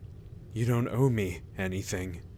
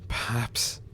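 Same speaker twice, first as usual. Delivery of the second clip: whisper; 4 dB quieter than usual. Acted. Guilt-ridden reply to reassurance.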